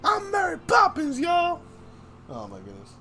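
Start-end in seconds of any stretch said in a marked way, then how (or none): 0.0-1.6 higher shouted declaration; mock announcement. Boasting to friends as Mary Poppins, higher shout.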